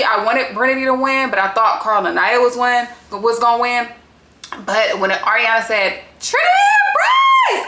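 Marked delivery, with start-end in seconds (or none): 6.2-7.7 high, loud, drawn-out winner announcement; announcer shout. Retold or imitated. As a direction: belt the names out like a host revealing winners. Retelling the host's winner announcement.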